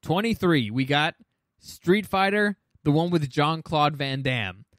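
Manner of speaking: ordinary talk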